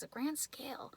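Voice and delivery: whispered in hushed awe; hushed whisper of wonder; awed whisper. Real, not acted. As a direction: breathe it out like a secret.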